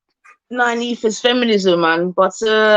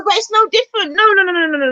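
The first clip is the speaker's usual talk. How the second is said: high protesting no; 13 semitones higher, faster than usual. Real, not acted. Protesting to his co-host, high insistent no.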